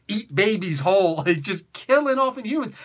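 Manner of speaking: ordinary talk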